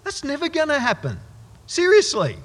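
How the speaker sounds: high, loud scoffing disbelief; seriously, never; incredulous. Real, not acted. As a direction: scoff at the very idea. Incredulous reaction to the parable.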